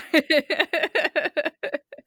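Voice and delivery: high light laughter. Real, not acted. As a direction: laugh along warmly. Laughs after remark.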